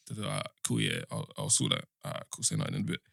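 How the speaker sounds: quiet, breathy near-whisper; hushed voice. Retold or imitated. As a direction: keep it low so colleagues can't hear. Quiet phone call at work.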